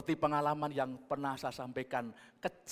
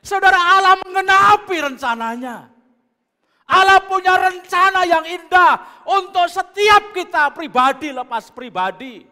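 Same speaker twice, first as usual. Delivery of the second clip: loud, high shouted proclamation; preaching at full force; 14 semitones higher, 19 dB louder than usual. Acted. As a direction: shout God's plan to the congregation. (khotbah berapi-api, berteriak lantang)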